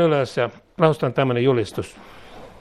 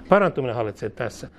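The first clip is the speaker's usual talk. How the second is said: ordinary delivery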